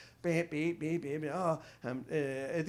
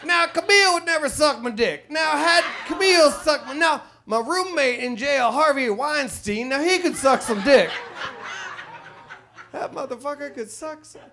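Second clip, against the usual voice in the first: Bill Cosby impression; 7 semitones higher, 7 dB louder than usual. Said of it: "my Bill Cosby impression".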